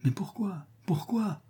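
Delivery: high, hushed indignation; strained, whispery protest with falling ends; furious questioning. A narrator's reading. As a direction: demand it in a thin, high, soft voice. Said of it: "Et furieux, il demanda".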